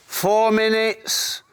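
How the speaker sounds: high, slow mock Clough accent, laughing at the end. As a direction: tease the young keeper, stretching each word. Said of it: "He's going".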